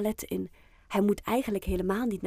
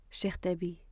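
ordinary delivery, nothing marked

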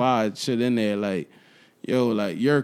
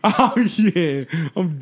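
laughing; 5 semitones higher, 4 dB louder, much slower than usual. Real, not acted. Laughing, trying to finish the story.

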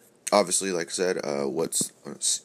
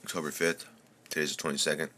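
normal talking voice

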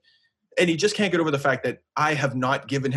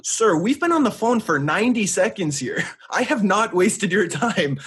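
high, slow, indignant with a laugh; 5 semitones higher, 4 dB louder, slower than usual. Retold or imitated. Scolding the rude rep, high and slow. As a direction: protest slowly, half laughing at him. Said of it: "And I'm like".

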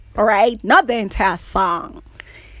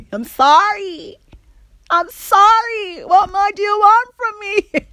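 mock apology; 10 semitones higher than usual.

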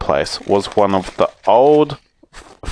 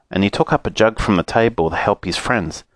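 plain reading voice

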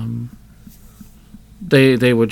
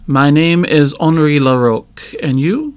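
put-on louder, French-accented introduction; heavy French accent; 3 semitones higher, 5 dB louder than usual. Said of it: "very heavily French accent".

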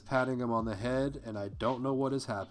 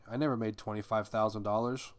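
ordinary talk, nothing marked

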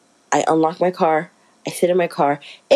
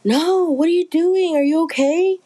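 much higher, alarmed friend voice; worried coworker voice; 11 semitones higher than usual. Retold or imitated. T asking her dizzy friend in car, high worried voice.

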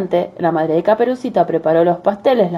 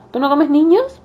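put-on little girl voice; 9 semitones higher than usual. Girl voiced in fairy tale.

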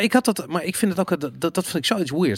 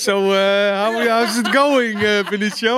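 mock interviewer voice; 7 semitones higher, 6 dB louder, slower than usual. Listener mimics the interview question.

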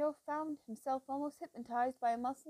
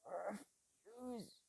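almost soundless, much softer; barely voiced; 4 semitones lower, 12 dB quieter than usual. A narrator's reading. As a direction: mouth the word faintly. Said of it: "mouth moved without sound".

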